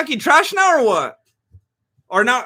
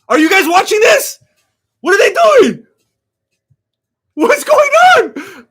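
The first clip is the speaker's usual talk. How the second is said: much higher, louder incredulous shouting; excited yelling; 9 semitones higher, 8 dB louder than usual. Real, not acted. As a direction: yell at the screen in disbelief. Yelling at bizarre fight ending.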